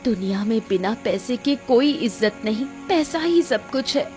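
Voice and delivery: steady your husband with wounded resolve; hurt, resolute wife. Acted. Narrator voices the wronged wife. (आहत पर दृढ़ पत्नी की आवाज़)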